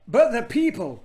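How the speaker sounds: put-on high, loud shocked boy; gasped. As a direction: gasp it out in loud protest. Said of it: "gasped Diggory".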